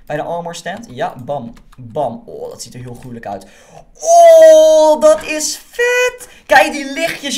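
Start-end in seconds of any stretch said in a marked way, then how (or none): 4.0-7.4 very high, very slow, loud drawn-out cheer; amazed shout. Real, not acted. Shows viewers the glowing armor, drawn-out amazed shout. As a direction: stretch it out in pure delight.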